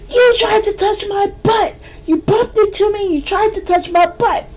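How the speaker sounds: high, loud, insistent complaint; shrill accusation. Retold or imitated. Feisty accusing the man on the street, shrill and loud. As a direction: keep hammering the blame, high and loud.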